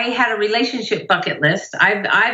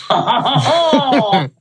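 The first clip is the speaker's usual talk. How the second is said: swooning; 3 semitones higher than usual. Retold or imitated. Acting her swooning inner reaction.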